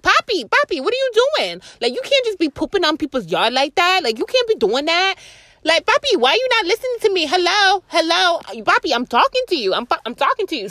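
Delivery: lady scolding her dog in a high voice; high scolding dog-owner voice. Retold or imitated. Lady in the rain scolding her little dog Papi, high-pitched and exasperated. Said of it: "she was like".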